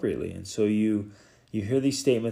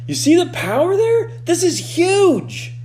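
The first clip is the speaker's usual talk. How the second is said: much higher and louder, thrilled; excited; 5 dB louder than usual. Real, not acted. Coach thrilled by the question's power, excited. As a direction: burst out with the discovery.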